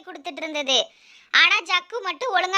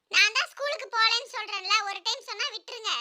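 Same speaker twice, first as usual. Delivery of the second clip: put-on soft, thin little-boy voice; defiant son Jakku; 3 semitones higher, 8 dB quieter than usual. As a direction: talk back to mother in a small voice. Son talking back to mother. (எதிர்த்துப் பேசும் சிறுவனின் மெல்லிய குரல்)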